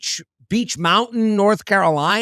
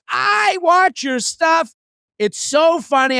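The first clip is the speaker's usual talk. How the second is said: high, drawn-out mock fan gushing; older woman fan; 10 semitones higher, slower than usual. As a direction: imitate an older woman in a raised voice. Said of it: "she's an older woman".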